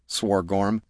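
plain reading voice

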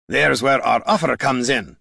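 firm dwarf voice with dialect; plain-dealing dwarf. Acted. Gorm to the Mask, frank dwarf voice. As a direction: speak as a dwarf confronting an old foe.

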